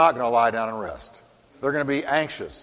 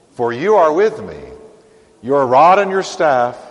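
scripture reading; 5 dB louder than usual. Acted. Pastor reading Psalm 23 aloud to congregation, solemn and loud.

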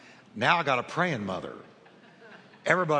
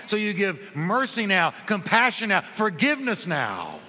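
preaching at full force; 4 semitones higher than usual. Acted. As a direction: drive the urgency home to the congregation. Climactic preaching on the now.

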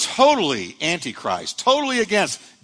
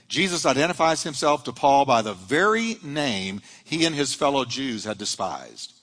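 ordinary talk, nothing marked

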